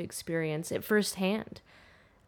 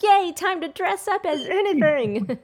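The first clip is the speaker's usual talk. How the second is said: much higher and louder, giddy cheer; excited cheer; 12 semitones higher, 10 dB louder than usual. Retold or imitated. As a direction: gush like a kid at Halloween. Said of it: "it was like".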